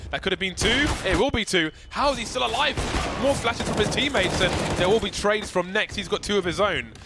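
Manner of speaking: high, loud hype call; excited. Real, not acted. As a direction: shout the trades out. Caster calling a multi-kill.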